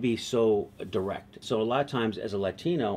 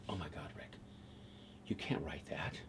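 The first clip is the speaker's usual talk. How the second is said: breathy whispered warning, far softer; hushed whisper; 6 semitones lower, 15 dB quieter than usual. Retold or imitated. Writer whispering his alarm to Rick in the room. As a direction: lean in and whisper it low like a scandal.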